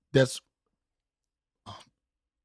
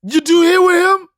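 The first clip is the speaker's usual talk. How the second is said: put-on high, loud toddler complaint; indignant toddler; 13 semitones higher, 7 dB louder than usual. Son turning to his mom, high loud toddler protest. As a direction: whine it at mom like a wronged kid.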